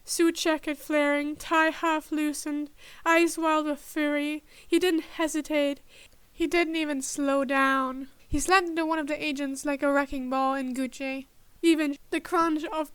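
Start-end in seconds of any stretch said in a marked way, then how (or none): none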